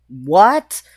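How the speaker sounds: loud yell with sharply rising pitch; yelled what. Retold or imitated. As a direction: shout it as a stunned question. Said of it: "And I did yell".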